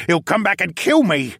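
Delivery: high, loud, panicky captive voice; frightened captive Luca. Acted. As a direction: voice a prisoner sure he will be killed.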